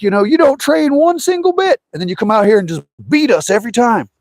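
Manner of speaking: high, mock-whiny rival griping; jealous rival. Rivals complaining to Danny.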